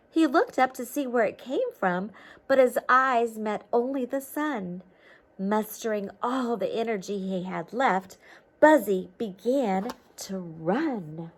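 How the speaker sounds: lively storybook read-aloud; reading to children. A narrator's reading. Teacher reading a storybook to children, lively read-aloud. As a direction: read it like a picture book to kids.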